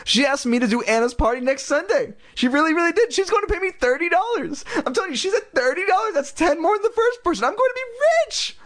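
very high giddy voice; excited girl. Acted. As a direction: rattle it off thrilled and high. Lindy excited about pay.

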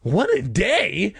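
high, stretched incredulous squawk; acted shrill outrage, drawn out; shrill cry. A narrator's reading. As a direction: echo it back in shrill indignation. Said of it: "I cried shrilly".

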